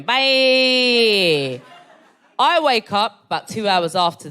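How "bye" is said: long drawn-out "bye"; breezy send-off. Retold or imitated. Waving off the one-night stand, breezy send-off. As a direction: hold the goodbye out like a mock send-off. Cheerful farewell as he leaves.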